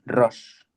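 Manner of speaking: "Rosh" said slowly with a rolled r and a final sh; Hebrew letter name. Shown as a demonstration. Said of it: "se dice Rosh".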